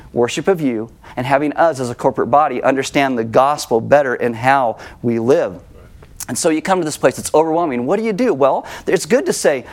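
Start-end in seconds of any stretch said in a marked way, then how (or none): none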